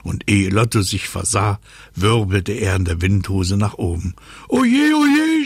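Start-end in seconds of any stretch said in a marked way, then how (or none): 4.5-5.4 very high, loud wail; squealing cry of a whirled-up little character; screamed. Acted. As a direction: wail in dizzy dismay. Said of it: "schrie Lotto".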